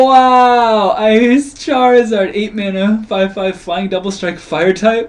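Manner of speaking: high, loud hype announcement; excited. Real, not acted. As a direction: announce it like a showman. Announcing a big card.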